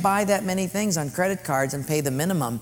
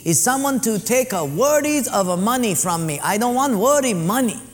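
higher, louder mock Japanese accent boss; broken-English mock Japanese accent, pitched up; Mister Sato; 6 semitones higher, 5 dB louder than usual. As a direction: play the sushi owner in a mock Japanese accent. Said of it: "Mister Sato said".

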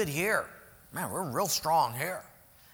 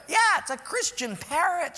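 high, louder proud lady; proud church lady; 11 semitones higher, 4 dB louder than usual. Acted. Church lady boasting about her parrot, high and proud.

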